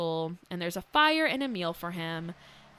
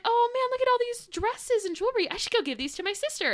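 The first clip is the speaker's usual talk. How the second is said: much higher, fast and excited; mock delighted Beauty; 14 semitones higher than usual. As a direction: gush over the gifts in a girly squeal. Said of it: "So she's like".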